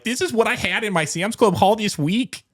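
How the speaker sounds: high mock Minnesota mom accent; chirpy Midwest mom listing her Sam's Club haul. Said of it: "That sounds Canadian".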